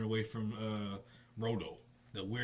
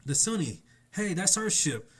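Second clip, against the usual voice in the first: higher and louder, spotting the ship; excited Luffy; 6 semitones higher, 5 dB louder than usual. Retold or imitated. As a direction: point and shout it out.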